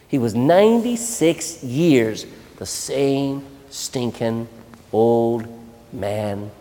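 ordinary delivery

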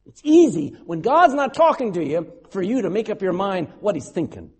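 usual speaking voice

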